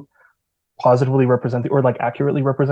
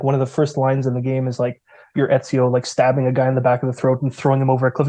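ordinary talk, nothing marked

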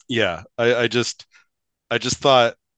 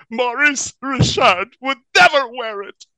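put-on pouting child; 5 dB louder than usual. Child protesting in read story.

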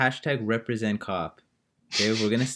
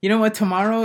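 mock defiant; 12 semitones higher, 7 dB louder, faster than usual. Mock defiant speech to listeners.

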